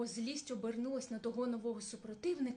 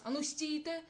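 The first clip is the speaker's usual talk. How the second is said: higher, louder strange bear's command; other bear shouting; 4 semitones higher, 3 dB louder than usual. A narrator's reading. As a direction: stop them with a barked order. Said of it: "кричить".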